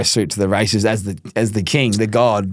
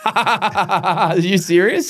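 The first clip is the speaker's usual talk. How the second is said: laughing disbelief; 6 semitones higher, much slower than usual. Real, not acted.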